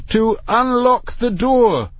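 high, slow, exasperated demand. A narrator's reading. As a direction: raise your voice and draw out each word. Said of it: "his voice now appreciably raised".

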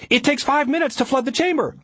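very high, urgent objection; shouted. A narrator's reading. Linda countering Ari mid-attack, urgent shout. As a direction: shout it with pitch held right up. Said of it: "Linda shouted".

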